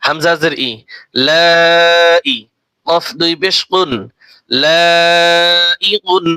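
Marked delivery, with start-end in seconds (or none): 1.3-6.2 very slow, the vowel held four beats long; slow Quranic madd; four-alif stretch. Shown as a demonstration. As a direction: hold the madd four counts. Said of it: "এখানে চার আলিফ টান".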